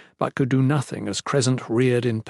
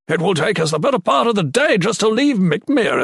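loud, raised shout on horseback; 8 semitones higher, 4 dB louder than usual. A narrator's reading. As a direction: shout over the rowdy crowd. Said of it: "Cardean shouted".

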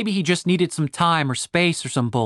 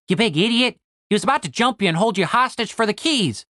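high-pitched, exasperated sidekick; Tim, scolding; 6 semitones higher than usual. Acted. Tim warns Cooper of a trap, scolding. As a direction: scold a friend in a raised pitch.

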